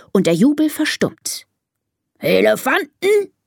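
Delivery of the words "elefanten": raised, put-on lion voice; calling lion. Lion addressing the winning elephants, calling out. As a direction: call out formally to the herd. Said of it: "ruft der Löwe".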